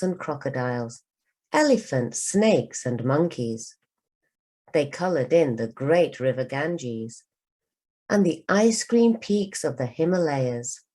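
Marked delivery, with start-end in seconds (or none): none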